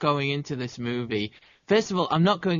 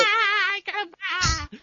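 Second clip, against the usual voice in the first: shrieked much higher, put-on Russian whine; mock Chekov scream; slower than usual. Podcaster mocks Walter Koenig's scream for his cohost, high mock shriek. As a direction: squeal it like a panicked Chekov with a bug in his ear. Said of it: "bitchy, high-pitched scream like".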